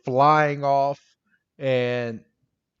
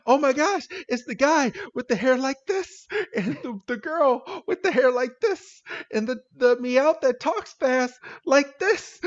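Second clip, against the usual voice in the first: much higher-pitched mock-frantic voice; excited character quote; 11 semitones higher than usual. She spots the thieves in the greenhouse, high frantic imitation. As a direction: squeal it out as a panicked witness.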